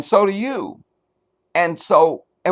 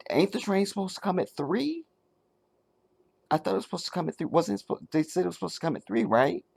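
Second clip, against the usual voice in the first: much softer, tentative questioning; uncertain asking; 2 semitones higher, 9 dB quieter, faster than usual. Retold or imitated. Stranger at the train asking about times: soft, unsure. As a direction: ask as if unsure of yourself. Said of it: "you're almost questioning while you're asking".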